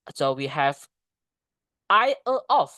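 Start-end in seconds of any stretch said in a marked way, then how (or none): 1.9-2.7 high, louder, choppy function words; nonsense demo. Shown as a demonstration. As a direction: say it as a puzzling string of loose words. Demonstrates meaningless structure words alone. (contoh ucapan janggal bernada tinggi)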